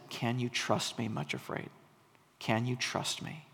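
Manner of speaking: soft, low and gentle, a quiet invitation; tender shepherd. Acted. The Good Shepherd gently asking Much Afraid for trust, soft and low. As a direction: ask it softly, as a loving guide. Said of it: "the Good Shepherd shows up and basically says".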